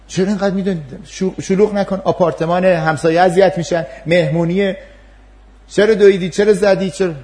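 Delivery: high, loud scolding; scolding parent. Acted. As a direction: tell the child off in front of guests. Parent scolding child at party.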